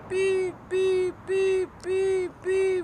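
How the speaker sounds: high, even mock monitor beeps. Son recalls the heart monitor at his mother's death, mimicking its beep. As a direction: copy the machine's beep, pitched high.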